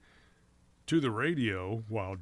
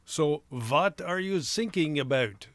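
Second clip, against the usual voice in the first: put-on louder, higher comic German accent; 5 semitones higher, 5 dB louder, slower than usual. Puzzled operator misunderstands sinking, German accent. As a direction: stretch the punchline out in a German accent. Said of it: "speaking with a German accent".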